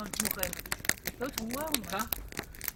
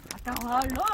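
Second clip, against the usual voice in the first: normal talking voice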